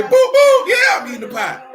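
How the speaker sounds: high, loud falsetto mock of the lady; mock falsetto pie confession; mocking her voice. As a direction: shout it up high, teasing. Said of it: "She was gonna say".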